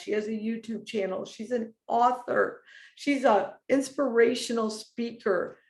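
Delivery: fighting back tears while telling it; getting emotional. Real, not acted. Telling her viewers about a friend's praise, choking up. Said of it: "now I'm gonna get emotional".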